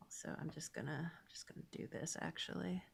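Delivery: whispering to self. Real, not acted. Muttering to herself while drawing.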